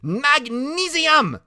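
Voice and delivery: high, loud, drawn-out cry; excited shout. A narrator's reading. Freak naming the firework chemicals, shouting high and slow. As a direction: call it out slowly at a high pitch.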